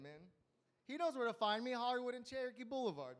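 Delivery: ordinary delivery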